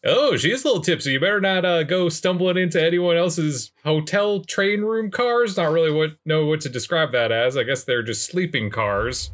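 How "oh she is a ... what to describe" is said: fake Texan accent. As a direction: play a Texas tourist with a put-on Texan accent. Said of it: "we are gonna do the whole episode like this".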